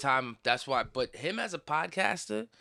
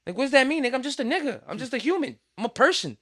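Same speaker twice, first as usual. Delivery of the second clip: confused protest, pitched up; pushing back, voice climbing higher; indignant, higher; 9 semitones higher, 4 dB louder, faster than usual. Retold or imitated. Recalling being called little Spanish boy, indignant and higher. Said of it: "make me feel like".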